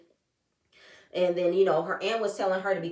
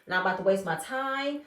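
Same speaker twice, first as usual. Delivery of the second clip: normal talking voice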